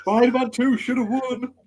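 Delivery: high-pitched mock outraged shout; hammed-up screaming protest. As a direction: wail it like a raging gamer. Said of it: "screaming for Uncle Jimmy".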